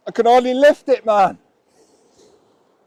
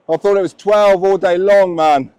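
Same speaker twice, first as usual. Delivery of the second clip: ordinary delivery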